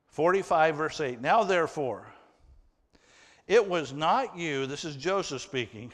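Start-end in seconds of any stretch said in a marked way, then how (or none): 1.2-4.6 measured Bible reading from the pulpit; solemn read-aloud scripture. Acted. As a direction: read the verse with weight.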